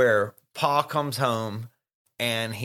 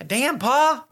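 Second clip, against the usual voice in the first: high-pitched indignant exclamation; mock outrage; 13 semitones higher, 4 dB louder than usual. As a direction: scold Pa in exaggerated disbelief. Mock outrage at Pa's demand.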